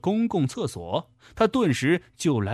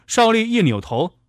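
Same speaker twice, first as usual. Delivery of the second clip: usual speaking voice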